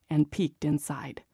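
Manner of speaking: ordinary delivery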